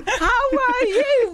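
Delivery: high giggly hello; laughing greeting. Real, not acted. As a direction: ask through laughter.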